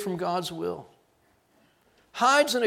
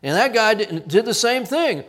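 ordinary delivery

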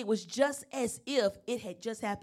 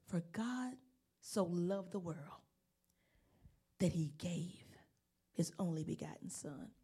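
hushed John three sixteen; soft reverent reading; 5 semitones lower, 9 dB quieter than usual. Real, not acted. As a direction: read the verse gently, as in awe. Reading the verse aloud.

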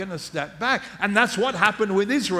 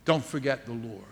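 softly repeating the plea; a low, gentle echo; hushed plea; 8 semitones lower, 8 dB quieter than usual. Acted.

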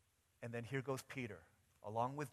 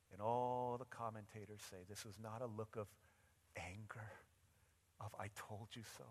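hushed preaching; 6 dB quieter than usual. Real, not acted. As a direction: let the story sink in, quietly. Preacher drops to hushed telling.

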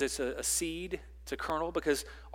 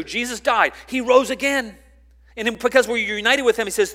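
loud, raised preaching voice; fervent pulpit delivery; forceful preaching; 5 semitones higher, 12 dB louder than usual. Acted. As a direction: preach it out to the congregation.

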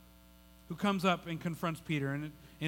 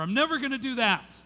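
loud, higher-pitched resolve; emphatic vow; 7 semitones higher, 7 dB louder than usual. Acted. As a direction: declare it with mock absolute certainty. Acts out a promise later broken.

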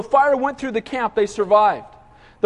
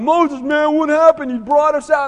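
higher-pitched griping at a leader; mock complaining; 4 semitones higher, 3 dB louder than usual. Israelites blaming Moses for bringing them out, mock complaining. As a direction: whine an accusation, hammed up. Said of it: "complaining and whining and moaning".

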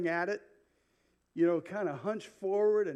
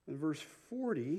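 ordinary talk

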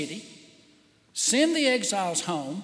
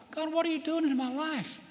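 exasperated plea; 7 semitones higher, faster than usual. Pretend. Listener complaining to God.

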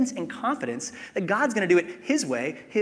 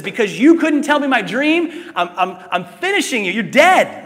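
louder, higher, furious king threatening; angry king; 6 semitones higher, 10 dB louder than usual. Acted. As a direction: roar it like a raging king. Angry king voiced in scripture.

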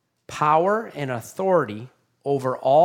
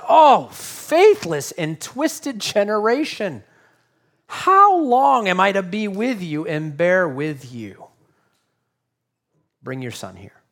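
exasperated; 2 semitones higher, slower than usual. Acted. Jesus rebukes a faithless generation, exasperated and disappointed. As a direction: drag it out in fed-up frustration. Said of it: "He's exasperated. He is perturbed. He's disappointed. And he says it".

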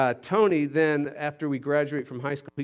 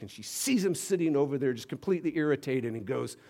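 usual speaking voice

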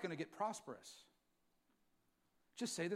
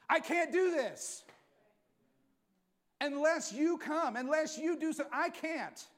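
earnest plea; 9 semitones higher, 8 dB louder, slower than usual. Retold or imitated. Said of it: "came to and said".

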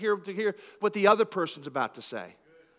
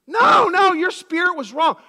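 much higher and louder; heated accusation; 11 semitones higher, 11 dB louder, slower than usual. Retold or imitated. Someone shouting back that your spirit was wrong. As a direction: fire it back in anger.

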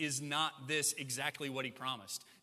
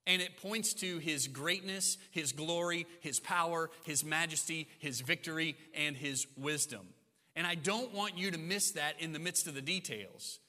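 ordinary talk, nothing marked

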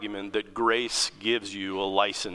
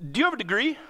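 much higher, pointed question from the supervisor; Wendy questioning; 11 semitones higher, much faster than usual. Retold or imitated. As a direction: ask it like a blunt supervisor testing him. Supervisor Wendy quizzing him.

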